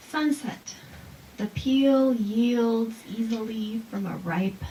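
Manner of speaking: slow, savoring haiku reading; poem reading. Shown as a demonstration. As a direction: read slowly, tasting each word. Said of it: "we come to taste".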